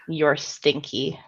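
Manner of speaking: ordinary delivery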